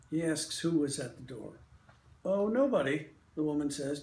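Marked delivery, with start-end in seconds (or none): 2.3-3.1 breezy high innocent reply. Acted. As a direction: play it innocent and airy. Wife covering innocently.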